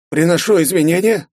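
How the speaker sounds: high, courteous apology; a rector excusing himself; polite rector. A narrator's reading.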